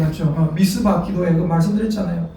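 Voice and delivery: normal talking voice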